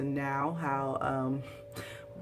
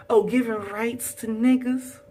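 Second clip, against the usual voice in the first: louder, higher, slow snooty mock voice; mocking imitation; 7 semitones higher, 6 dB louder than usual. Mimicking the snobby wife, mocking voice. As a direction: sneer it back like the wife.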